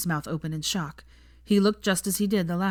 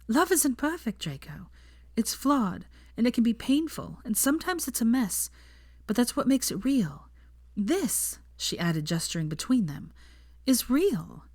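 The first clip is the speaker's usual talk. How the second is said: higher, earnest female voice; Hermione, earnest; 6 semitones higher than usual. Acted. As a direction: plead with him that love is real.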